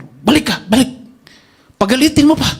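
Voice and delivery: eager urging. Retold or imitated. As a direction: urge him on gleefully.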